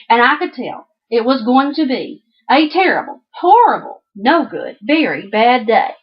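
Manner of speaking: lively read-aloud storybook voice; reading to children. Acted. Teacher reading a picture book to the class, lively.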